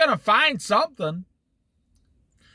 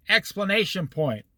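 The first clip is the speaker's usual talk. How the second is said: ordinary talk, nothing marked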